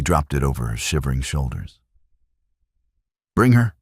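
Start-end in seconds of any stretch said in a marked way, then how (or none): 3.4-3.8 loud, high barked command; commanding order. A narrator's reading. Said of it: "Kyle ordered his men".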